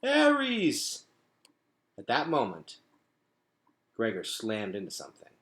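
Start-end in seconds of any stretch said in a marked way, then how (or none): 0.0-1.0 high desperate cry for the bat; desperate plea. A narrator's reading. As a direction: call out in despair as you fall. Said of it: "Henry's desperate plea".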